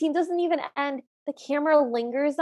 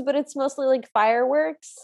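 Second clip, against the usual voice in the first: normal talking voice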